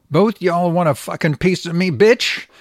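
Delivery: retold yell. Host quoting the boy taunting officers, mock yell. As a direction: throw it at the cops like a cocky kid. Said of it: "the six-year-old boy yells".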